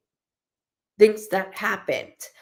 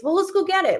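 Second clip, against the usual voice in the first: much higher, keen reply; eager offer; 10 semitones higher, faster than usual. Retold or imitated. Friend eagerly offering to fetch Chinese food, keen. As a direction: jump up to go get food. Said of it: "somebody's like".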